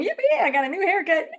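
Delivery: sarcastic fake joy; mock glee. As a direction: pretend to be thrilled about the haircut. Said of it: "I'm certainly not going".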